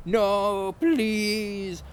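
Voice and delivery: hammed-up desperate plea; begging. A narrator's reading. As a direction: beg for mercy. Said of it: "Lumpkin begged".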